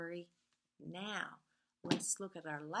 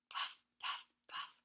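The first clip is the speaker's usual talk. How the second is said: whispered; 4 dB quieter than usual. A narrator's reading. Whispered train refrain.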